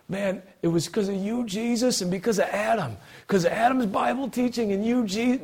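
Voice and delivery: high-pitched imitation of a misguided believer; mock gushing gratitude. Acts out misguided thanks in heaven.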